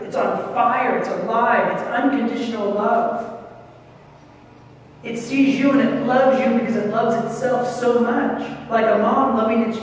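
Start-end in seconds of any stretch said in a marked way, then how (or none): none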